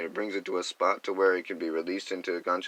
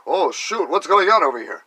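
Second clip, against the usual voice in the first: sudden loud surprise, higher; startled outburst; 11 semitones higher, 13 dB louder than usual. Retold or imitated. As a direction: jolt alert, calling out. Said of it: "I'll be like".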